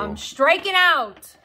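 high, drawn-out complaint; exasperated, stretched-out cry; loud lament. Real, not acted. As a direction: wail it slowly and loudly. Complaining about scoring no points.

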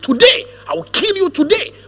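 a pastor voicing David's bold dare; defiant challenge. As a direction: throw it at the giant. David defying Goliath, retold.

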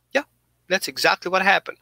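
ordinary talk, nothing marked

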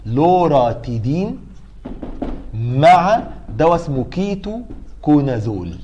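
slow, stretched drug names with pauses between; slow dictation. Shown as a demonstration. Lecturer gives students an exam drug combination, slow deliberate dictation. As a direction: dictate each name deliberately, syllable by syllable.

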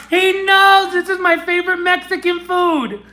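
high-pitched excited exclaiming; thrilled gushing. Real, not acted. Thrilled by favorite food sticker.

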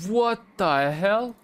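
high, drawn-out incredulous question; startled disbelief. Real, not acted. Player startled by what the game shows, aghast.

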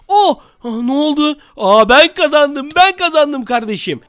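high, drawn-out victory crow; triumphant gloating. Real, not acted. Winner taunting his rival after the kill, triumphant gloating.

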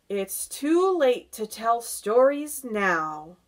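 loud, drawn-out pronouncement; slow announcement. A narrator's reading. Old grasshopper ending storytime, slow firm announcement. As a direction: announce it slowly and firmly. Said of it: "the old green grasshopper announced".

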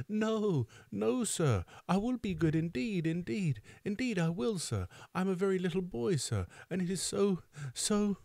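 put-on high, tearful little-boy voice; sobbing plea. A narrator's reading. Orphan boy promising the beadle to be good, acted sobbing. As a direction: beg in a small, high, pleading child's voice. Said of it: "sobbed Oliver".

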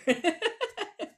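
light self-amused laugh; a quick real chuckle at herself; brief giggle; short laugh. Real, not acted. After pudding joke.

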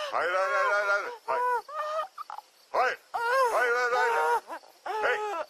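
high, loud frantic cries of refusal; panicked shouting. Acted. Father to captor threatening his daughter, panicked shouting.

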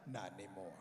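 very low, grave reply. Retold or imitated. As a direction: drop it deep and final. Deep solemn reply to the lady.

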